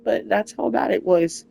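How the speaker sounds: ordinary talk, nothing marked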